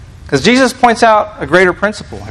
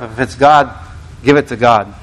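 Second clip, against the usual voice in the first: God said lower, passed over lightly; little emphasis; 5 semitones lower than usual. Shown as a demonstration. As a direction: let God slip by without weight. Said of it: "not as much emphasis on the part of".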